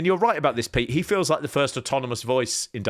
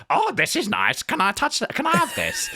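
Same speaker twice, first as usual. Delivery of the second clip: imitated childlike wonder, pitched up and rising; covetous little request, climbing higher with each question; Danhausen; 12 semitones higher than usual. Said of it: "he just goes".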